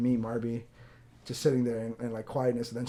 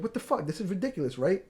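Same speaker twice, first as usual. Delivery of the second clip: imitated New York woman, higher and indignant; heavy New York accent; 7 semitones higher than usual. Woman complains at the front desk, heavy New York accent. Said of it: "she had a really heavy New York accent".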